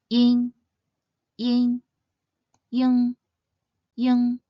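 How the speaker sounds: "yin" ends on n, "ying" ends on ng, both with a nasal buzz; nasal finals. Shown as a demonstration. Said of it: "feel a slight buzzing sensation in your nasal cavity".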